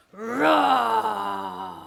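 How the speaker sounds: huge roaring lion bellow. A narrator's reading. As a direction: let out a big lion roar. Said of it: "how loud he could".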